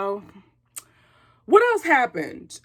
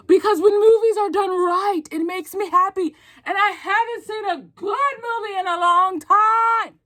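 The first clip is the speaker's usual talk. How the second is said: high, loud excited shout; excited yelling; 6 semitones higher, 4 dB louder than usual. Real, not acted. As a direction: yell it out of sheer movie joy. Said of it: "I'm yelling again".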